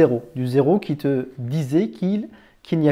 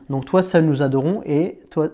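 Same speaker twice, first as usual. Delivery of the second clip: ordinary talk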